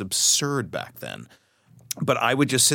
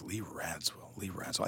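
puzzled murmur; 14 dB quieter than usual. Retold or imitated. Repeating an unknown name, puzzled.

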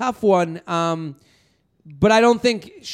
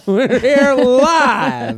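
slow sportscaster voice; 6 semitones higher, 4 dB louder, much slower than usual. Pretend. Joking sportscaster bit.

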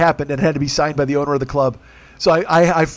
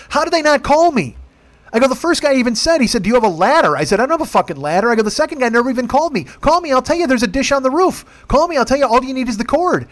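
exasperated rant; 7 semitones higher than usual. Retold or imitated. Retelling his rant at Owen.

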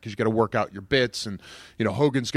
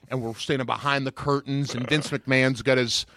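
ordinary talk, nothing marked

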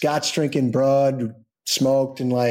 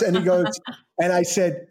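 ordinary talk, nothing marked

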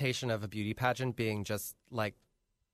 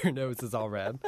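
ordinary delivery

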